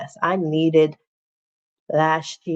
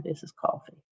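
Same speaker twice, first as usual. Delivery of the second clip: coffee whispered unvoiced, much softer; whispered aside; 10 dB quieter than usual. Real, not acted. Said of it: "This is not water".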